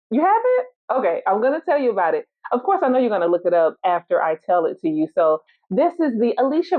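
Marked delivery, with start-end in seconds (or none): 0.1-1.0 mock surprise. Feigned reply to audience.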